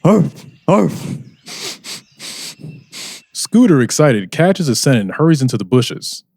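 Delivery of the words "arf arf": dog barks. Acted.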